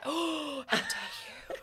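gasping, high, breathy mock outrage; mock offense. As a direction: act scandalised, laughing.

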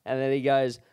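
ordinary talk

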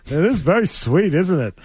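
lightly spooky Count voice; vampire voice. Pretend. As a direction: purr it like a cartoon vampire. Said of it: "What are you, Count Chocula?".

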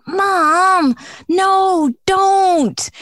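put-on high, loud whiny kid protest; loud whiny "Mom, no"; kids whining. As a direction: plead like a kid stopping mom.

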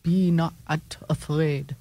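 loom over them like a campy vampire; Dracula voice. Pretend. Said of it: "the vampire voice".

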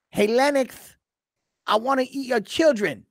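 quoting the famous threat in his squeaky voice; Mike Tyson impression. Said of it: "pretty good, right?".

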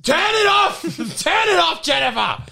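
high loud yell; shouting at the crew to switch it off. Pretend. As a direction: yell across the room to cut it. Shouting at crew to cut intercom.

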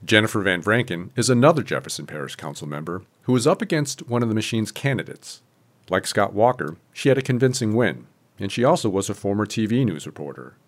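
scripted radio reporter delivery with punched key words; news report read. Acted. Reporter introducing election results to listeners, news read.

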